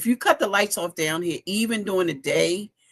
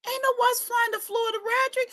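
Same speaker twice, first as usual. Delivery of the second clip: high incredulous. Real, not acted. Mocking her son's claim.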